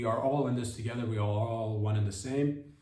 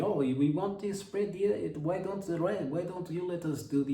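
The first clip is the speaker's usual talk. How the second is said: mocking foreign voice; 6 semitones higher than usual. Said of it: "other voices externally doing things and saying".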